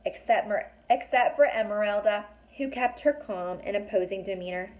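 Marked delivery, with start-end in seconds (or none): none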